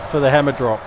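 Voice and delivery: high, loud gushing; delighted, tumbling over words; excited. Real, not acted. Thrilled by fireworks in the game, loud and excited.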